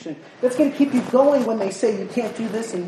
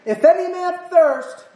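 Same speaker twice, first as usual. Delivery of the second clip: loud, high proclamation over a crowd; a ringing public cry; shouting; 10 semitones higher, 7 dB louder than usual. Acted. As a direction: call out to everyone at the feast. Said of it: "he's shouting and he says".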